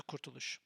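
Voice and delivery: Kurtuluş stressed on KUR; KUR-tuluş, the place-name stress; stress on first syllable. Shown as a demonstration. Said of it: "you mean the location".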